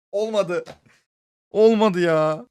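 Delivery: loud, high groan of frustration; frustrated shout. Real, not acted. Gamer yelling at a missed kill, frustrated. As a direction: yell it at the miss.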